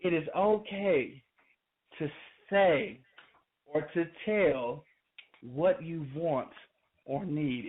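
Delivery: slow, spaced out, word by word; patient, pausing after each phrase. Shown as a demonstration. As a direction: spell it out slowly for the ladies. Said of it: "I wanna slow it down for you".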